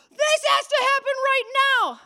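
high, loud, insistent demand; shrill the demand like an upset member; imitating an angry congregant; demanding caller. Angry person demands meeting.

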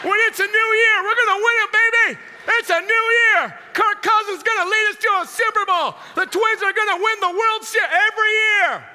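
high, loud shouting of a delusional fan; hyped-up sports fan. Acted. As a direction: yell with blind faith in your team. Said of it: "Every year we think our team's gonna win".

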